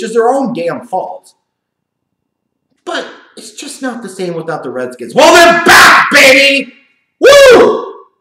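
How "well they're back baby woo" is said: hyped yell, high and loud; excited shout. Acted. Sports commentator hyping the Redskins' comeback, a loud excited whoop. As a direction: whoop it up like a hype man.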